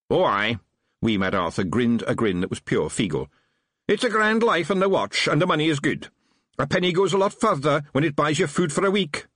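high, lively Scottish Feegle; chirpy little Scots policeman. A narrator's reading.

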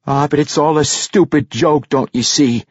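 high-pitched bitter insistence; worked-up young dwarf insisting; insistent. A narrator's reading. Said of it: "Bruner insisted".

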